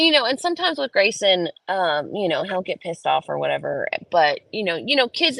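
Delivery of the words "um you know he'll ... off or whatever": ordinary delivery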